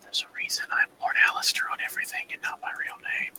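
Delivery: fast breathy whisper; hurried secret whisper. Acted. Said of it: "Why are you guys whispering?".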